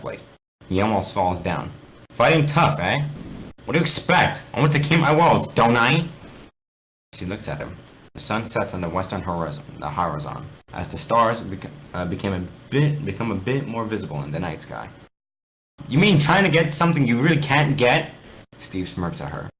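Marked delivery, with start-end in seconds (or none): 2.1-6.1 high, loud defiant taunt. Acted. Alex taunting during fight. 15.9-18.1 high, loud scornful jab; smirking retort. Acted. Steve smirks at her.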